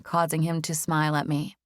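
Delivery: usual speaking voice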